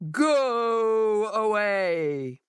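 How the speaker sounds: slow, booming warning through the door; booming monster. A narrator's reading. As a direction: boom it out like a hidden beast. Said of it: "the voice boomed out".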